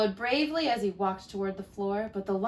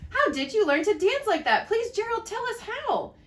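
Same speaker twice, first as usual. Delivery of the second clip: eager pleading; 10 semitones higher than usual. Acted. Animals begging Gerald.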